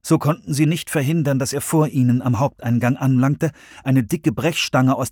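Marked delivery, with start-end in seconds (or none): none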